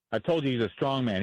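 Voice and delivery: normal talking voice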